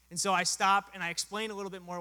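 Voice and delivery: ordinary talk, nothing marked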